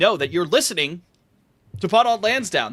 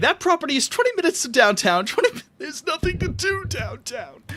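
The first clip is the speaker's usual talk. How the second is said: mock villain voice; 5 semitones higher, 3 dB louder than usual. Quoting a movie villain, then a realtor.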